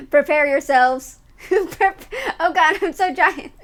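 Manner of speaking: giggling through a loud, grand warning; laughing doom-herald; laughing mock warning. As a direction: announce your giant arrival while cracking up. Cat avatar about to step on chat.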